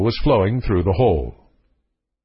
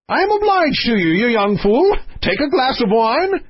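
high-pitched, gruffly gracious monarch; blustering king; 10 semitones higher than usual. Acted. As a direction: play a testy king offering thanks.

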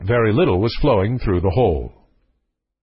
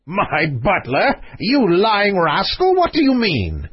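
high, drawn-out royal indignation; enraged king; 6 semitones higher, slower than usual. Acted. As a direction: play an outraged king, pitched up and slow. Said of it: "opened the door in a rage".

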